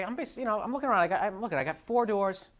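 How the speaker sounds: usual speaking voice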